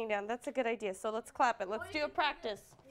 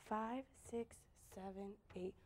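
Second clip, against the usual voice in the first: very soft, near-whispered counting; hushed count-in; 4 semitones lower, 16 dB quieter than usual. Real, not acted. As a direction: count the class in under your breath. Quiet count-in before clapping.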